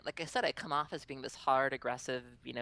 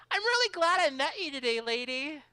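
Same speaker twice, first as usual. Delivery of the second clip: put-on high, loud child voice; sweet little boy; 11 semitones higher, 7 dB louder than usual. Boy to the player who beat him, high sweet child voice. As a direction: imitate an earnest little kid.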